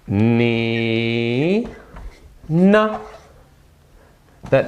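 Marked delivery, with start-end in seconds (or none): 0.1-3.0 teacher modelling 你呢; careful Mandarin. Shown as a demonstration. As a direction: say 你呢 slowly with a pause between. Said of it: "how do we say, "And you?".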